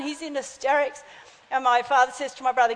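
ordinary delivery

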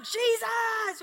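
high, loud drawn-out yell; victory shout. Retold or imitated. Leader running across the oval with the ministers, victory shout. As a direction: shout the name like a battle cry. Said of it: "give a shout of victory".

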